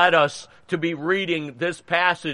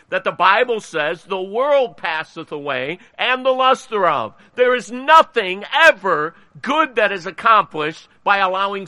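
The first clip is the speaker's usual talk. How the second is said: earnest pulpit exhortation, raised pitch; preaching; 4 semitones higher than usual. Real, not acted. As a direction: preach it with emphatic punches on key words. Preaches, closing exhortation before the poem.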